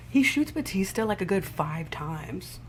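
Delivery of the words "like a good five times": ordinary talk, nothing marked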